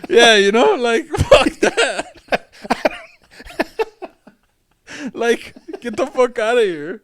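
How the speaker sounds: high, loud scoff through laughter; very slow laughing fuck that. Real, not acted. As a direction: brush it off while cracking up. Laughing at the idea.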